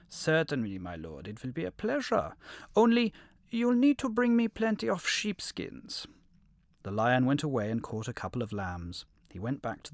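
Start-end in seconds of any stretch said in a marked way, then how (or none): 0.1-6.1 wolf voice. A narrator's reading. Wolf answers the lion.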